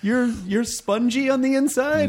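high, drawn-out mock astonishment; incredulous question. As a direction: rise high and slow in playful disbelief. Mock disbelief at tough guy's softness.

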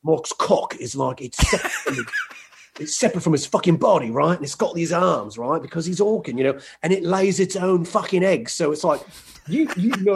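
bad British accent, intense and sweary; darker British accent. Shown as a demonstration. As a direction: rant in mock British, laughing at first, then rising in pitch. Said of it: "Same thing, but darker, more intense".

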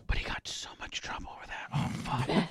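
hushed whisper into the mic. Real, not acted. Host confiding the wedding trouble to co-hosts, whispering. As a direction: whisper it like forbidden gossip. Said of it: "Why are we whispering into the mic?".

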